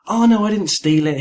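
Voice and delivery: high, loud mock denial; mock protest. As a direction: protest innocence in a put-on way. Acted protest of accused player.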